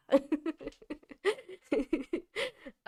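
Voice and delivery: high, breaking giggle; giggling laugh. Real, not acted. Joking to viewers about two passports, giggling. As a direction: laugh lightly at your own joke.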